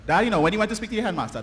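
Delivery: ordinary delivery, nothing marked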